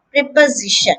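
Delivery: prep-uh-ZI-shun; "preposition" as prep-uh-ZI-shun; third-syllable stress. Shown as a demonstration. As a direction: lean on ZI in "preposition", s said as z. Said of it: "the stress is on the third syllable".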